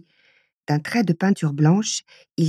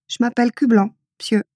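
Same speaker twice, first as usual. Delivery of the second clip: put-on rustic country accent; 6 semitones higher, 4 dB louder, faster than usual. A narrator's reading. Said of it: "avec un fort accent campagnard".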